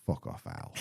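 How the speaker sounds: soft, unvoiced deadpan; a hushed blunt brush-off; mock deadpan swear. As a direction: drop it flat and soft. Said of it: "[name]'s put it forward and went".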